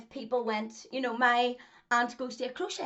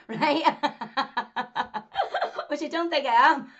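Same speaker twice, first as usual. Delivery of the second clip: louder, laughing through the line; 4 semitones higher, 6 dB louder than usual. Real, not acted. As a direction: laugh off the boast. Laughs at calling herself genius.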